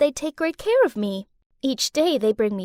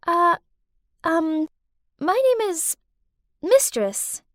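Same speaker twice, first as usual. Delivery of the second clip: halting, higher-pitched, with long pauses; hesitant introduction; 5 semitones higher, slower than usual. Acted. Mistress introducing herself to captive girl, hesitant. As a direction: feel your way haltingly to the name.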